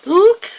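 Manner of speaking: high, loud pop noise; popping sound effect. Pretend. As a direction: mimic the wrapper popping open. Sound of package popping open.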